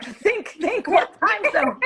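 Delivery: high, puzzled mock musing; confused self. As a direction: act flustered doing time-zone math. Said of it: "I'm like".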